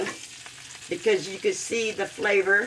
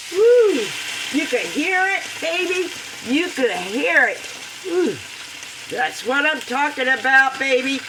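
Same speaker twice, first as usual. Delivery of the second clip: higher, louder hyping the sizzle; thrilled hype; 6 semitones higher, 4 dB louder than usual. Real, not acted. Pouring sauce for viewers, higher and louder hype. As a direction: hype it up like a cheerleader.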